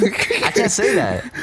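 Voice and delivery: laughing protest. Real, not acted. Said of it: "laughing mock protest".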